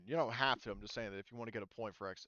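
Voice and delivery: ordinary talk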